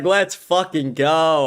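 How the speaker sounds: slow, drawn-out victory cry; imitating a triumphant cheer; retold celebration. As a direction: savor the win, stretching every word. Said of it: "I was celebrating".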